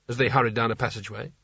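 ordinary delivery, nothing marked

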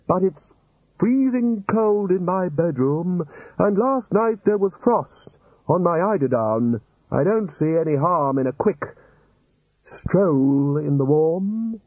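high, slow wheedling wizard voice; wheedling old wizard. Acted. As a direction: coax them gently toward the beach. Chair pleads for warm stroll.

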